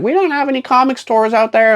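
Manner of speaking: put-on high-pitched old lady complaint; hammed-up granny falsetto. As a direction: protest like a cartoonish old woman. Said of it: "I'm gonna do my old lady voice".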